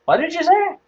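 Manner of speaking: high, incredulous question; mock disbelief, high-pitched; taken aback. Retold caller stunned by insult.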